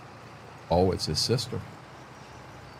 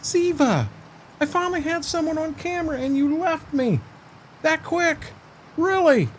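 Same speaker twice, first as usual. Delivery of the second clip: mock hurt. Real, not acted. Dog left him on camera.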